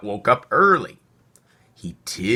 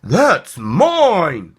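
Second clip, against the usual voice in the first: sharp, higher and louder squeak; 6 semitones higher, 6 dB louder than usual. Acted. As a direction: squeak out the claim to the spoon. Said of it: "squeaked the squirrel".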